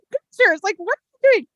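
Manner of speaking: high, disbelieving outburst; incredulous. Retold or imitated. Wife hearing her husband took pictures, high incredulous disbelief. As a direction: sound floored by what he did.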